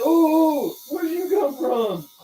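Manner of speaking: high-pitched mock surprise; startled question. Replaying the drunk man's reaction for laughs, hammed-up surprise. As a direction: act out being caught totally unawares.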